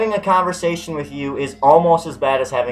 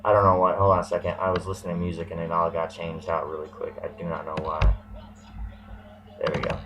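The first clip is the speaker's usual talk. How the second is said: muttering off to the side, much softer; a distracted aside while the music glitches; quiet aside; 9 semitones lower, 9 dB quieter, faster than usual. Real, not acted. Vlogger mutters to himself while fixing the music, quietly.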